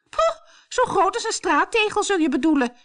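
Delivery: mocking yell. A narrator's reading. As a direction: yell a sneer at her sister. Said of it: "schreeuwde Truida".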